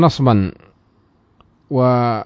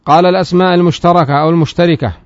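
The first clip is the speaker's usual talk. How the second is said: raised, louder recitation of the source text; reading the text aloud; 5 semitones higher, 6 dB louder than usual. Shown as a demonstration. Teacher reciting the grammar text to students, raised voice. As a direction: recite the matn line for the class.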